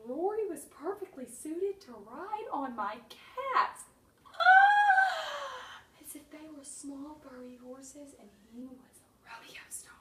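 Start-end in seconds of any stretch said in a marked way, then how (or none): 4.3-5.8 high loud excited yelp; a thrilled squeal. Real, not acted. Sudden cry. 6.0-9.9 soft whispered aside; whispering. Real, not acted.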